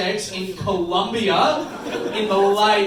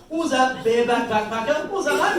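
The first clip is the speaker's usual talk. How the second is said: cheeky, higher, louder jab; mock comeback; 6 semitones higher, 4 dB louder than usual. Son firing back at his mum, cheeky and mocking. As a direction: taunt her with a sneer.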